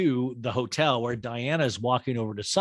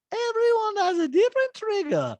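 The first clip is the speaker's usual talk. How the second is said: Diana's accent impression; 5 dB louder than usual. Host imitating Diana's talking head, very high Bosnian accent. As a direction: deliver it in a put-on Bosnian accent, deadpan. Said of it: "And Diana goes".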